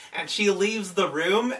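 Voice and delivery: ordinary talk, nothing marked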